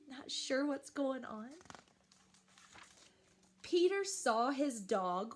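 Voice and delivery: ordinary delivery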